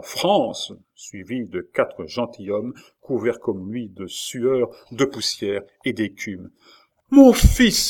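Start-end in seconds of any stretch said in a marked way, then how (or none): 0.0-0.7 rider's cry. A narrator's reading. Young man gallops into the courtyard, crying out. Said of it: "entra dans la cour en criant". 7.1-7.8 high, very loud call; exclaimed cry. A narrator's reading. Mother greets her son from the window, crying out. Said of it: "s'écria Catherine en étendant les deux bras".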